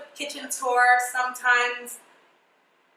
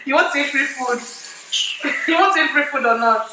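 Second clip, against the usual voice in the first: louder, playful scolding challenge; mock bossy demand; 3 semitones higher, 6 dB louder than usual. Host teasing helper about washing plates, mock bossy. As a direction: press the helper like a pretend boss.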